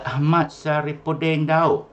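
teacher calling a silent pupil's name in an online roll call. Real, not acted. Teacher calls absent pupil's name.

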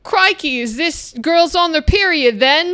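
hammed-up Australian drifting into British; bad Australian accent. Host attempts an impression for friends, bad Australian accent. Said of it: "I was about to do an Australian accent".

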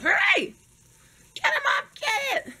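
high frantic urging; urgent high cries. Real, not acted. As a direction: hurry her up, squealing. Burnt herself, urges mom.

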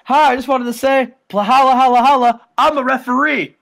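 a swaggering GM with his catchphrase; Teddy Long. Retold or imitated. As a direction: hype it up like a smooth talker. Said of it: "He says, he says".

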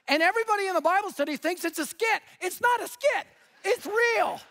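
high, heated, emphatic telling; worked-up storytelling. Real, not acted. Pastor telling the congregation about the public fight, worked-up. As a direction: build to an incredulous, high-pitched insistence.

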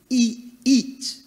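high, loud cries of indulgence; gleeful command. Acted. Rich man telling his soul to feast, high and loud. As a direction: shout it like a feast is starting.

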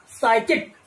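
साइकिक said as "saikik", silent p; साइकिक with no p sound, "sai-kik"; pronunciation demo. Shown as a demonstration. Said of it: "इसकी जब हम pronunciation करेंगे".

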